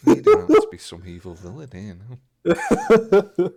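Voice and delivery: loud, amused accusation; real laughter through the words; laughing protest. Real, not acted. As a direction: object while cracking up. Laughing at the tease.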